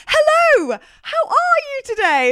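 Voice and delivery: very high, loud sing-song hello; beaming customer-friendly voice; overly sweet greeting. Retold or imitated. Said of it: "I was like".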